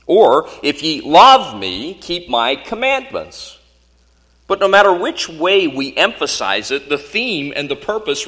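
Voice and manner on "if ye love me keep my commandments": "love" loud and drawn out, "commandments" pitched high; stress on LOVE and COMMANDMENTS. Shown as a demonstration. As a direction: swell on "love", then lift "commandments" high, slowly. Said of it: "emphasizing the words in different fashions".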